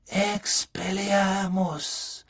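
slow, drawn-out croaked spell; croaking. Acted. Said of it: "krächzte er".

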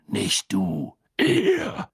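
raspy growl leaping up on the last word; rough, snarled correction; Moody's growl. A narrator's reading. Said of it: "knurrte Moody".

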